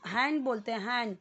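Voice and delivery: हैंड said as "hen", no d sound; हैंड without the d; silent d. Shown as a demonstration. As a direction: say हैंड with the d dropped. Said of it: "डी पूरा नहीं बोलते".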